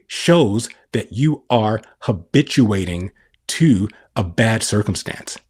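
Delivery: ordinary talk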